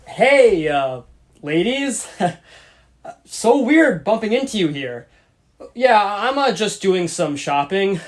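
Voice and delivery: loud awkward wannabe smooth talker; try-hard cool guy drawl. Acted. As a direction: force the swagger, nervous laughs. Awkward pickup attempt sketch.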